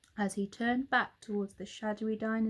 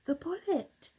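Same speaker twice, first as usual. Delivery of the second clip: surprise; 8 semitones higher than usual. A narrator's reading. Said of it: "William and Santa said at the same time".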